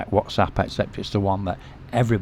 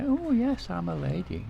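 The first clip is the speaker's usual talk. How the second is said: high, pleased and smiling; pleased smiling reply; 10 semitones higher, 5 dB quieter than usual. Retold or imitated. Lady beams back at the compliment, pleased and smiling. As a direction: answer like a flattered lady. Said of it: "she'll smile and say".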